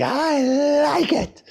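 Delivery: high giddy Chevy Chase squeak; high excited squeal. Retold or imitated. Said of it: "Like Chevy Chase in that one movie".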